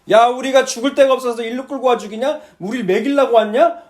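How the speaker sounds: loud, indignant grumbling at the leader; angry complaint. Retold or imitated. Israelites blaming Moses, loud and indignant. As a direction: throw the blame at him loudly.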